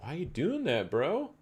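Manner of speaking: incredulous rising bro; surprised. Real, not acted. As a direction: pitch shooting up in disbelief. Surprised question at chart.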